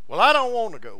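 whiny protest. Retold or imitated. Wife refusing to go to apartment.

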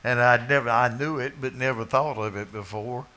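normal talking voice

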